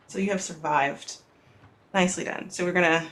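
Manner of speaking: ordinary talk, nothing marked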